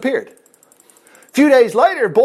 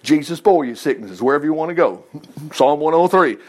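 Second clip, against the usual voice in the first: ordinary talk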